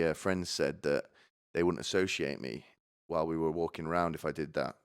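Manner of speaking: ordinary talk, nothing marked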